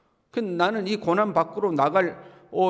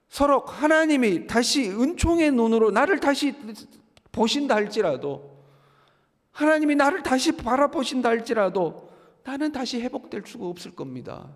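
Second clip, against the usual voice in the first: despairing Job; 7 semitones higher than usual. Acted. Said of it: "완벽한 절망 속에 이제 욥이 빠진".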